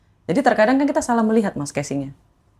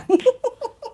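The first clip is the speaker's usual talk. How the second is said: a bright, high burst of laughter; a quick high giggle at her past self. Real, not acted. Laugh after the retold plea.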